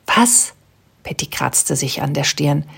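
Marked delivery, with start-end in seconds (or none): 0.1-0.5 startled what. A narrator's reading. Patty startled.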